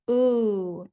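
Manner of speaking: "oo" held alone as in moon; long oo sound. Shown as a demonstration. Teacher sounding out baboon, long isolated "oo". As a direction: draw out "oo" as one isolated vowel. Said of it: "that oo sound is spelled with two O's".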